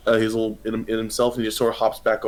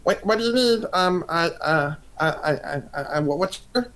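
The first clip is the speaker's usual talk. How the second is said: much higher, stammering little creature voice; flustered slime waiter; 7 semitones higher than usual. Acted. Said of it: "He's like".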